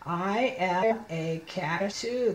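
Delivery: slow, separated words; slow model reading. Shown as a demonstration. Teacher reading hairless cat page, slow word-by-word. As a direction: read word by word for a beginner.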